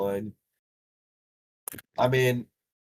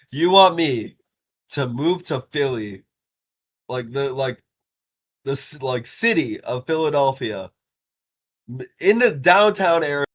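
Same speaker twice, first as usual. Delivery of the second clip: retold disbelief, higher and louder at first; mock incredulity; 4 semitones higher, 7 dB louder than usual. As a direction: act out a baffled mock outrage. Mocking the door-to-door job offer.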